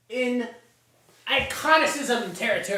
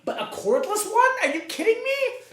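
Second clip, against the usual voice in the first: much higher, incredulous squeal; mock disbelief; 10 semitones higher than usual. Ranking household items, he squeals in mock disbelief. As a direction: act floored by the cordless twist. Mock outrage at cordless vacuum.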